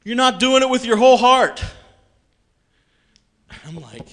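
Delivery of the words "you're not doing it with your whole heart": booming, much higher and louder. Retold or imitated. God answering the seeker, a booming call. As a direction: thunder it down from above. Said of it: "really loud I heard".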